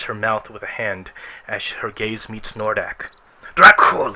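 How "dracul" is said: sudden high, loud cry of fear; startled parishioner cries out; yelled name. Acted. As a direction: shriek the name in alarm. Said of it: "she yells".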